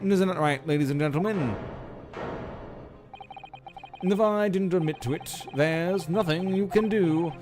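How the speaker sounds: villain voice. Acted. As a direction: sneer it at the courtroom. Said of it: "do the villain voice now".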